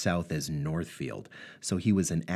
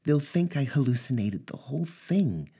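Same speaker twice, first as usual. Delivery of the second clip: higher woman's voice, close to tears; Natasha's voice, tearful; 8 semitones higher than usual. Acted. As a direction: play the shaken ex-wife, voice raised in pitch. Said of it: "she sighed".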